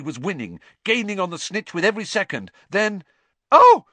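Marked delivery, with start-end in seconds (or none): 3.5-3.9 sudden high loud scream of alarm. A narrator's reading. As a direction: shriek it, startled and scared. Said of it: "screamed Cho".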